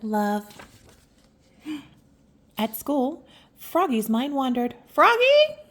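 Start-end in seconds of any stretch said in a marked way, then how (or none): 4.9-5.6 high sharp call of the name; teacher calling out. Acted. Teacher calling out to daydreaming Froggy, sharp and high. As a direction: snap his name to wake him. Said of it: "cried his teacher".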